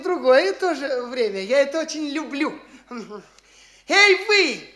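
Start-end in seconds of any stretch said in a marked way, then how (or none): 3.9-4.7 loud high call across the room. Acted. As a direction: hail him sharply, raised voice. Khlestakov calls out to official. (громкий окрик)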